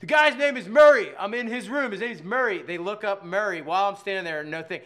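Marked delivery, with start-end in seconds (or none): 0.0-2.6 insistent. Retold or imitated. Insisting to clerks.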